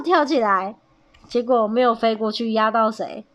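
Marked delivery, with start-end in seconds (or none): none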